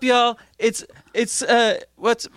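influencer voice. Acted. Influencer starts video intro.

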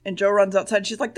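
ordinary talk

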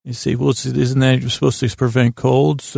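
ordinary talk, nothing marked

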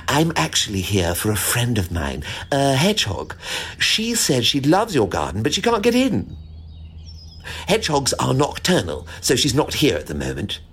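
lightly voiced chatty squirrel.

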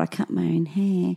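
a hint of a small child, quiet and sulky; soft mock kid voice owning up; lightly voiced five-year-old. Mocking her like a five-year-old owning up, a quiet childish hint. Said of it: "It's like a five-year-old".

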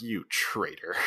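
low, hushed mock betrayal; muttered accusation. [name] looking at Archie in the bed, low mock accusation. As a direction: drop it low and quiet, accusing.

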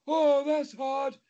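slow, stretched words; drawn-out repeated lament. Retold or imitated. Woman repeating herself over and over, slow drawn-out moan. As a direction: stretch it out like a weary refrain. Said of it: "she kept saying".

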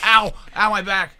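sudden high cry of back pain. Real, not acted. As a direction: yelp as the back seizes up. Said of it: "Oh, I laughed too hard".

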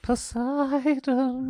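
high, very slow ghostly call; eerie female voice. Acted. Friend imitates the eerie dream voice, high and drawn out. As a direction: stretch it out high and spooky. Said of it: "It was a female, but was it eerie?".

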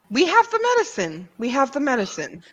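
quick, high, insistent repetition; hurried reassurance. Acted. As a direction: rush to reassure the frantic captive. Urgently reassuring the frantic captive.

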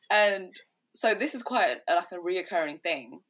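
ordinary delivery

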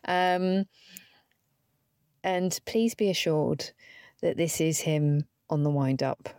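usual speaking voice

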